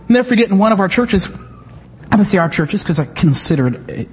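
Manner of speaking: usual speaking voice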